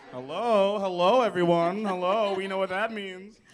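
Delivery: high, loud, put-on hello; loud high showman greeting; performed greeting voice. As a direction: call out hello to the crowd in a put-on voice.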